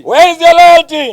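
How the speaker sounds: high booming rally shout; booming ideological slogans. Real, not acted. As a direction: call out to the crowd at full force. Call and response slogans.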